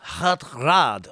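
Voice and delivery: mock French name said flat and level, giving up; French accent. Tired friend repeats the name in mock French accent. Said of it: "French accent".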